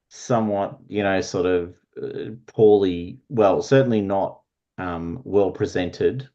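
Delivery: normal talking voice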